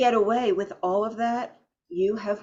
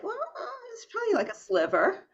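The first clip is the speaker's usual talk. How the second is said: higher, hesitant mock client; sheepish client voice; 14 semitones higher, 3 dB quieter, faster than usual. Coach imitates a client admitting he was barely nice, sheepish and higher. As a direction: play the embarrassed client admitting it. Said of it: "they're like".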